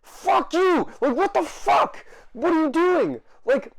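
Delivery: high, loud enraged shout; furious yell. Real, not acted. Vlogger raging at the couple mocking him, high furious shout. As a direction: scream it at them, unhinged.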